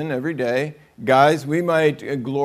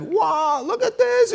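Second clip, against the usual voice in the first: high, gushing show-off voice; hammed-up delight over a new outfit; mock admiring squeal; slower than usual. Woman showing off her new outfit, high gushing.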